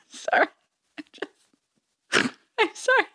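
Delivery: high-pitched giggling through the words; real laughter breaking up her talk, slowed down; laughing. Real, not acted. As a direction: she can't stop laughing at herself. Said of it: "I'm laughing because".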